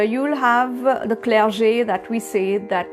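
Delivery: ordinary talk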